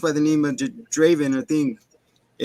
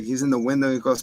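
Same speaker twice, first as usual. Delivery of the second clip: usual speaking voice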